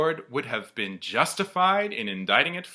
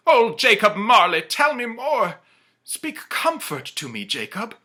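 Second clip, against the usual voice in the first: imploring; 10 semitones higher, 10 dB louder than usual. Acted. Scrooge begs Marley for comfort, high and pleading. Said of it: "he said imploringly".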